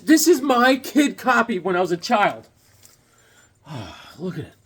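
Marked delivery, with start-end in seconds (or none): none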